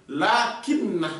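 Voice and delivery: lakinna said slowly, the double n held; stressed lakinna. Shown as a demonstration. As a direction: lean on the nn of lakinna. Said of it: "Lakinna, c'est-à-dire". (lakinna lent, nn appuyé)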